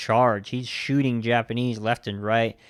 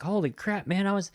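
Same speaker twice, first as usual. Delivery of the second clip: much higher, incredulous rush of words; squeaky, hammed-up can't-believe-it voice; mock disbelieving relief; 8 semitones higher than usual. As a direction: act out amazed survivor relief. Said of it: "Ben was just like".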